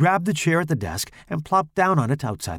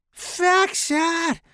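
put-on very high, girlish shrieked order; high-pitched shriek. A narrator's reading. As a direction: shriek it in a squeaky falsetto. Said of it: "Larry shrieked".

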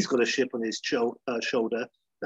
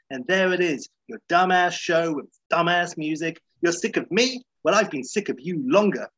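higher, mildly indignant diva rant; a hint of a furious pop star; 6 semitones higher, 3 dB louder than usual. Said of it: "[name] is like".

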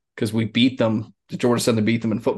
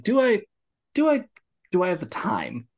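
self-questioning; 9 semitones higher than usual. Retold or imitated. Acts out debating whether to engage.

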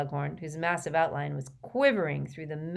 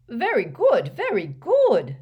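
loud, higher, delighted praise; happy; 6 semitones higher, 9 dB louder, slower than usual. A narrator's reading. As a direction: beam with approval. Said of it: "said Slughorn happily".

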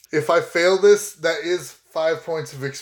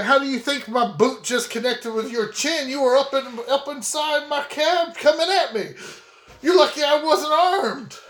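high-pitched, indignant protest; heated retort; 8 semitones higher than usual. Acted. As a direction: push back hard at the deputy. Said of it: "I was like".

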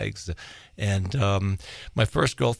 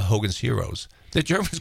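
usual speaking voice

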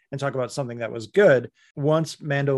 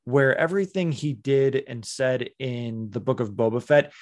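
usual speaking voice

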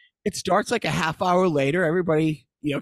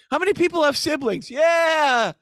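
son's opening line; 7 semitones higher than usual. Retold or imitated. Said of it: "his opening line was his best line".

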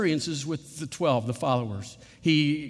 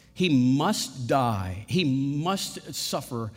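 slow, with "must" stretched out; stress on MUST; 4 semitones lower, much slower than usual. Shown as a demonstration. Preacher explaining obedience to the Father, slow weight on "must". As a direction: hold and press each "must". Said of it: "look at the emphasis on the word must".

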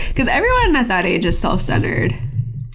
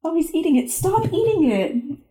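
real exasperated scolding; 8 semitones higher than usual. Real, not acted. Podcaster to Mort, who is eating something, exasperated scolding. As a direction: scold a pet in a high voice. Scolding the dog mid-recording.

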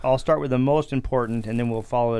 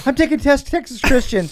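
mocking impression; 12 semitones higher, 5 dB louder than usual. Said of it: "he goes".

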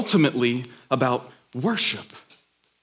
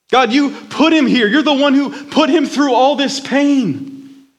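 pleading anguish at God, louder; anguished crying out; 8 semitones higher, 3 dB louder than usual. A narrator's reading. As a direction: cry out to God in grief. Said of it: "he cried aloud".

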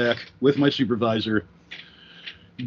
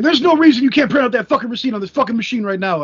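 higher, louder angry rant; angry customer; 9 semitones higher, 4 dB louder than usual. Retold or imitated. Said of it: "is like".

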